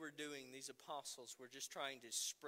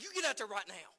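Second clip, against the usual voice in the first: loud stern command; stern dad order; 6 semitones higher, 10 dB louder, faster than usual. Retold or imitated. Father ordering his son back to play, loud and stern. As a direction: bark it at the kid. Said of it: "I'm like".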